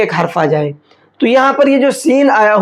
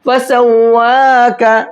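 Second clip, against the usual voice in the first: slow फसव्वाका with the vowel after waw held one alif; correct, drawn out; 3 semitones higher, much slower than usual. Shown as a demonstration. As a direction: stretch the वा in फसव्वाका properly. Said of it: "कैसे पढ़ेंगे".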